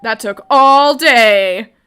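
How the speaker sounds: very slow and loud; slow loud complaint; drawn out. A narrator's reading. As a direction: stretch it out wearily. Narrating long passage of time.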